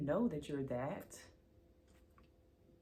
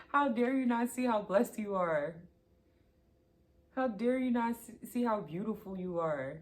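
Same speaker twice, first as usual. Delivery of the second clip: mock scolding; 3 semitones higher, 8 dB louder than usual. Reader mock-scolding the viewer for doubting themselves, loud and playful. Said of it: "I'm just playing, but I'm serious".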